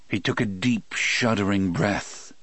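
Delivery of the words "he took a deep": ordinary delivery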